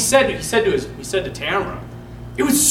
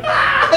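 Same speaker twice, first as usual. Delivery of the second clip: very high, loud yelp; mimicked yelp of a smacked kid; kid's yelp; 4 dB louder than usual. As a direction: cry out like a swatted child. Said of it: "And he's like".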